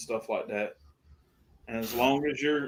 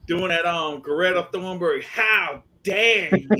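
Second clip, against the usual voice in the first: high, slow, outraged Greta voice; slow indignant How dare you; Greta Thunberg impression; 5 semitones higher, 6 dB louder, slower than usual. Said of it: "when you do this voice".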